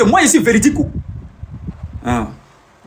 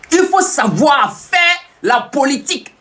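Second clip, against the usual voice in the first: emphatic shout; 6 semitones higher, slower than usual. Real, not acted. Lecturing viewers on politics.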